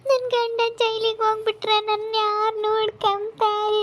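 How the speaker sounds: high, slow crying lament; sobbing out her fear of being left alone; wailing lament. Acted. Wife crying that her husband will go to jail.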